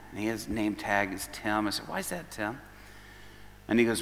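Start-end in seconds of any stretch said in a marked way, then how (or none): 1.9-2.6 softer, hushed question to a hurting man; gentle question. Retold or imitated. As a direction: ask it quietly, leaning in. Gently asking the troubled purser.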